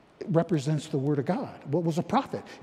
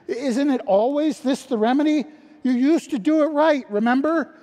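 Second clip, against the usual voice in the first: impassioned preaching; 10 semitones higher, 8 dB louder than usual. Real, not acted. Preaching at full force.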